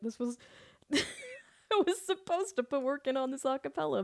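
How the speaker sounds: ordinary talk, nothing marked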